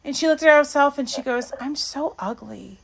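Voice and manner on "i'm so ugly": put-on soft, small voice of a sad little girl. Little girl softly calling herself ugly. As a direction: say it softly like a child hurt by her reflection.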